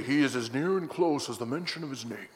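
put-on low, quiet Scottish-accented old pastor; imitated pastor with a Sean Connery Scottish accent. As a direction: mutter it in a Scottish burr. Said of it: "he didn't sound that much like Sean Connery".

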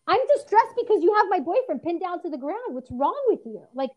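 loud, high, indignant tirade; outraged protest. Retold or imitated. Girlfriend confronting the officer, loud indignant outrage. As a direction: let the outrage spill over at the cop. Confronting police over pinned boyfriend.

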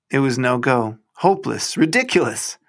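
ordinary delivery, nothing marked

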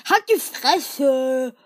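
slow, loud "Halt die Fresse!"; drawn-out furious yell; angry shout. Acted. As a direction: bark it out, dragging each word. Running gag shout at Spike.

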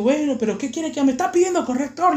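high, protesting mock yell; retold yell. Student yells back at teacher over corrector, high protest. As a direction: protest it up high, half-shouted. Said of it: "le grité".

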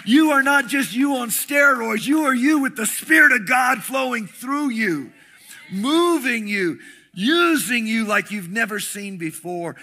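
loud, high, rousing preacher's proclamation; full-throated revival preaching; preaching at full force. Acted. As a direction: preach it out to the back row. Preaching climax to the congregation.

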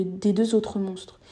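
plain reading voice

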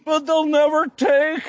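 slow, high, shouted rallying cry; mock battle cry. Wallace shouting to his army, hammed-up battle cry. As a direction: roar it like a hero rallying troops. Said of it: "did not shout".

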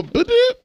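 very high electronic blip; imitated device beep. Redoing Lana's alert for friends, high mock beep. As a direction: chirp like a gadget switching on. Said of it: "Lana will".

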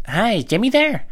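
high, slow, polite put-on voice; a hammed-up sweet caller; mock caller. Caller asks for brother.

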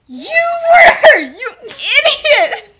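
high, loud, drawn-out mock yell; hammed-up shriek at a clueless friend; exasperated outburst. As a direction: blurt it out at full exasperation. Remus's acted exasperated outburst.

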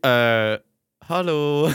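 hesitant, drawn-out mock greeting; awkward hello. Answering the new office mate's greeting, awkward hello. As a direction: act out an uneasy reply.

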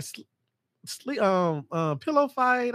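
high, hesitant mock-ditzy voice; mocking ditzy friends. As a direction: play dumb, stalling. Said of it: "they're like".

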